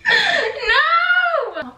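high, loud wail of no; laughing cry. Real, not acted. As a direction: cry out half laughing, half sobbing. Cry of despair.

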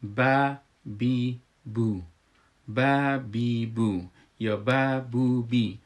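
slow syllable drill با بی بو; spelling drill. Shown as a demonstration. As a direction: sound out با بی بو slowly. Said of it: "اگه یادتان باشه".

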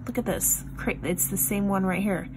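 usual speaking voice

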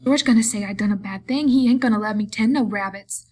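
put-on loud, distressed childlike complaint; frightened pleading. A narrator's reading. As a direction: plead in fear of getting in trouble. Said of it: "began to cry with fright".

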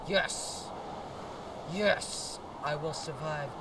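loud, high cheer; triumphant shouting. Real, not acted. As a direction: crow triumphantly over surviving. Celebrates escaping in game.

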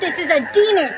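loud, high frightened cry; frightened realization. Acted. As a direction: gasp it out in terror. Girl realizes baby is demon.

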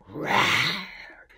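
low pretend roar; playful lion roar. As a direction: growl like a storybook lion. Imitates the lion for children.

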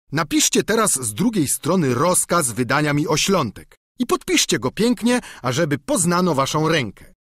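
lightly voiced eager peasant squire; simple squire pleading with his master; Sancho the squire.

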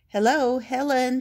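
loud, high shout of hello; shouted greeting. A narrator's reading. Spot shouting to Helen in her kitchen, loud and high. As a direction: call out to Helen over the noise. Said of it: "shouted Spot".

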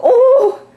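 loud, high startled "oh!"; sudden high cry of alarm; startled yelp. Real, not acted. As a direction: yelp as if it just moved. Startled while showing the bug.

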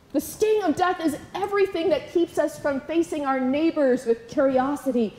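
ordinary delivery

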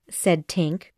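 ordinary delivery

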